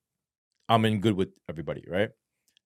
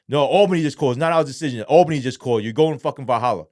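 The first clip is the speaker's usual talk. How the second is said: insistent guard; 4 semitones higher, 7 dB louder, faster than usual. Retold or imitated. Guard insists, orders from above.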